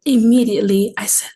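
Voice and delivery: normal talking voice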